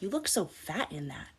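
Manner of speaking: hushed, cutting insult; mock quiet sneer at a family dinner; snide jab. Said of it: "they say some shit to you like".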